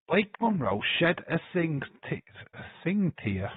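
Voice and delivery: "sing" said in place of "single"; misread word. Retold or imitated. Reading a chat line aloud, saying "sing tear". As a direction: read "sing tear" as written, clipped word. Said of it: "why is it a sing tear?".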